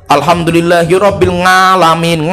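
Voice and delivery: "ngalamin" opening on a nasal ng, drawn out slowly; ng for ain. Shown as a demonstration. Teacher shows Indonesians' faulty recitation, "ngalamin" begun with ng. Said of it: "Nga gitu loh".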